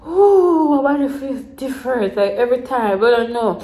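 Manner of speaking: shaky and tearful, unwell; tearful, feeling sick. Real, not acted. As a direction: voice wavering and close to tears. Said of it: "me about to cry".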